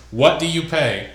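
normal talking voice

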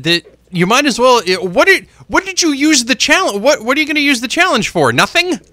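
high-pitched sarcastic disbelief; mock the manager for wasting it; incredulous mockery. Real, not acted. Mocking the Yankees' unused challenge.

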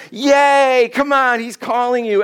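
high, loud mock cheer; crowd cheering. Preacher acts the crowd suddenly welcoming blind men, exaggerated cheering. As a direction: cheer them on with gushing excitement. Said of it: "now the crowd was like".

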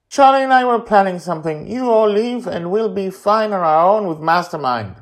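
put-on high, loud, fast kid; high hurried child's voice; eager boy voice. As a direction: rush it like a bossy little boy. Child Charlie pleads.

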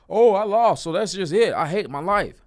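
high, loud mock whine of a sore loser; hammed-up pity party after losing; mock self-pity. To viewers, mocking a defeated loser: high, loud whiny self-pity.

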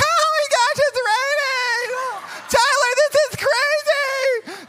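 high, loud, stretched-out squeal; giddy wife impression. Wife squealing to her husband in the rain, mock giddy. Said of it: "she's laughing".

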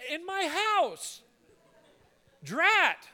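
high, loud mock-panicked squeal; high squeaky mock horror at the rat; mock shriek. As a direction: shriek it like a startled lady. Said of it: "I'm like".

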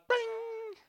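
sudden high squeak; sound effect. Acted. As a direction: pop it like eyes snapping open. Owl snapping its eyes open.

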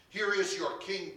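loud, high presentation; announcing to a crowd. A narrator's reading. Pilate presents Jesus to the crowd, announcing loudly. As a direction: proclaim it out to the crowd.

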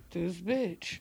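whispered under the breath; muttered under breath. Retold or imitated. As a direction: hiss it to yourself as they leave. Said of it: "when they walk off".

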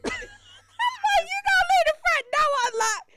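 high, loud, slow incredulous; mock disbelief. Teller reacting to aunt's offer, high slow disbelief. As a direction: act floored by the offer.

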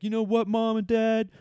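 high, loud, drawn-out mock outburst; defiant declaration. Next generation telling Mom and Dad, hammed-up defiance. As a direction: shout it off like a rebel leaving home.

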